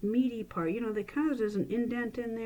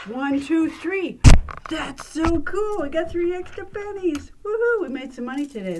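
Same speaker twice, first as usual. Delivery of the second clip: mock amazement; 8 semitones higher, 11 dB louder than usual. Magician faking delight at three extra pennies, loud and high. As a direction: ham up the wonder at the extra coins.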